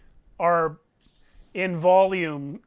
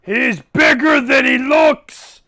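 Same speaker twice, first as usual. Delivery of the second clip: higher, loud, slow alarmed shout; giant's shout; 5 semitones higher, 7 dB louder than usual. Acted. Giant warning the other giants about Throck, loud alarmed shout. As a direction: warn the others loudly like a rattled giant. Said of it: "points at Throck and says".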